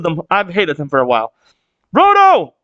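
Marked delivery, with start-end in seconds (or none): 1.9-2.5 high, loud cheer for the character; excited cheer. Real, not acted. Fan cheering a favourite character, excited shout. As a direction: call out his name with delight.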